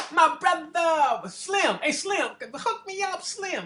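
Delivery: very high, slow, drawn-out calling; sing-song a street hustler's sweet-talk; imitating a hustling bum. A bum calling out to the passer-by for a handout, very high and stretched.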